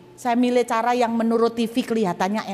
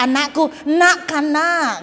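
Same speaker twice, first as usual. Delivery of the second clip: loud, higher mock Madurese accent; 6 semitones higher, 5 dB louder, slower than usual. Shown as a demonstration. Preacher showing how Madurese call a child, Madurese accent. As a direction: exaggerate a Madurese parent's call. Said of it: "Itu logatnya Madura".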